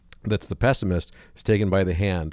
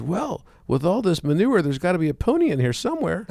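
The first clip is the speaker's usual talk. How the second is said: put-on hopeful little boy; 11 semitones higher than usual. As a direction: deliver the punchline like a beaming child. Optimist boy's punchline.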